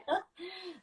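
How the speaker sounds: high, soft laugh; real high little laugh. Real, not acted. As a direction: laugh lightly at the listener's shock. Laughs at listener's astonishment.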